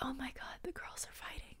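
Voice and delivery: hushed gossipy whisper. Retold or imitated. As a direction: whisper it like spotting juicy drama. Gossipy aside about fight.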